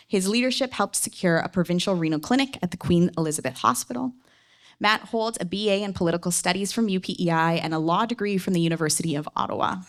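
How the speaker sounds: ordinary talk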